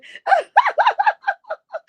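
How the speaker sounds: loud high laughter. Real, not acted. Big laugh after comment.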